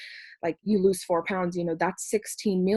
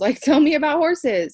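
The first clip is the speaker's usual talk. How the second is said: high, loud laughing plea; laughing ask; 8 semitones higher, 8 dB louder than usual. Retold or imitated. To the girl about horses, high and laughing. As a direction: laugh through it, eager to hear more.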